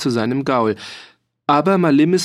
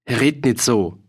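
mildly annoyed reproof; indignant. A narrator's reading. Farmer rebuking the laughing mercenary, a touch indignant. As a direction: cut off the joking with displeasure. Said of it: "sagte Ruhn Otter unwillig".

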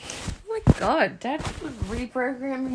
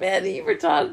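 loud and higher, cracking up; laughing mock insult; 7 semitones higher, 7 dB louder than usual. Teasing mum's reaction to dad, laughing loudly. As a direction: laugh out the jab. Mocking the dad's obliviousness.

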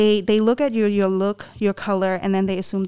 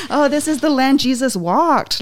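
higher, louder retold wonder; mock naive awe; 5 semitones higher, 5 dB louder than usual. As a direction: play the awestruck pilgrim, lightly hammed-up. Retelling naive awe on arrival.

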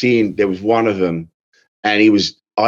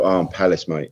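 offhand knowing; 5 semitones lower, 5 dB quieter than usual. Retold or imitated. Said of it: "then I'll just go".

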